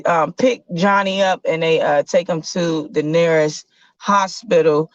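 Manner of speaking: normal talking voice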